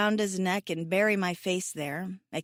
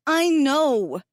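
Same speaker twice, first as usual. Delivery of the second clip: higher, louder outburst; cry out; 7 semitones higher, 7 dB louder than usual. Acted. Mahdi cries out at Hassan, higher and louder. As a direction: fling it back at him, raised and exasperated. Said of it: "I cry out".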